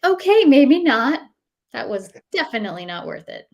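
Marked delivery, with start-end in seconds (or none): 0.1-1.2 higher, louder, slow mock backing off; rueful retreat. Herself after the yogurt, mock rueful. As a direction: concede with a pained laugh in it. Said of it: "And I was like".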